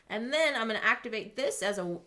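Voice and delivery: ordinary talk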